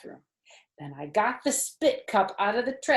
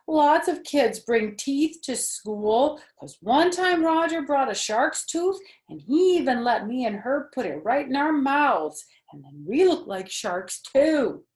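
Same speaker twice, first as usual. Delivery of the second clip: put-on whiny pleading kid; 7 semitones higher, 6 dB louder than usual. A narrator's reading. Junie B argues with her parents, in a high whiny plead. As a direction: plead your case like a stubborn kid.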